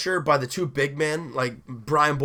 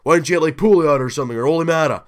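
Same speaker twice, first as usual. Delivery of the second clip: mimicking complaining fans, much louder; loud mock complaint from naysayers; mocking critics; 8 dB louder, faster than usual. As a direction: parody the know-it-all doubters. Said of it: "Everyone's saying".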